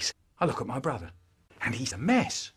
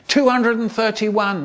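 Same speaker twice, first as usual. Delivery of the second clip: higher, bright guess from May; May's guess; 9 semitones higher, 5 dB louder than usual. Retold or imitated. Babysitter May topping the boy's guess, higher and brighter. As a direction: pitch it up like the babysitter. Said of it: "May would say".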